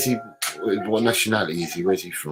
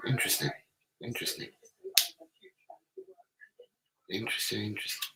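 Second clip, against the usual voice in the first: hushed murmur to himself; barely voiced, late-night hush; whispering; 14 semitones higher, 10 dB quieter, faster than usual. Real, not acted. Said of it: "Why are you whispering?".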